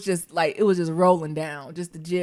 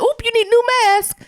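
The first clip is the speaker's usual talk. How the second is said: much higher mock nurse, brisk and chirpy; nurse's voice. Retelling the nurse swapping my wet mask, in a much higher mock voice. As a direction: voice the heavy-handed nurse up high.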